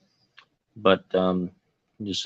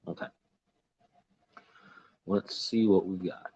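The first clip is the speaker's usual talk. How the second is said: ordinary talk, nothing marked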